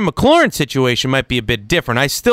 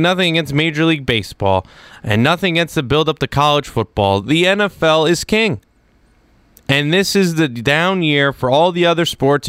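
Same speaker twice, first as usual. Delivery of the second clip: ordinary talk, nothing marked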